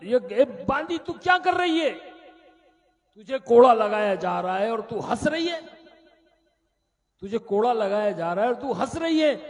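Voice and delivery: king yells at the maid; king shouts at the laughing maid in fury; furious king. Acted.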